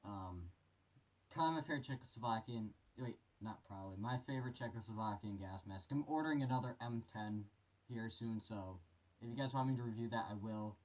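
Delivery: muffled voice inside a respirator; through a gas mask. Real, not acted. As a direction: talk from behind a gas mask. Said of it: "put this mask on here for you guys".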